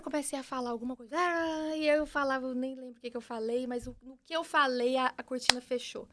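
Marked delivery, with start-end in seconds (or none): none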